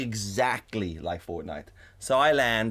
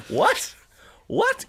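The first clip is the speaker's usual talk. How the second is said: high-pitched, shocked double take; incredulous; 5 dB louder than usual. Retold or imitated. Friend catches him on the game, incredulous. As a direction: squawk it in mock disbelief. Retells her shocked reaction.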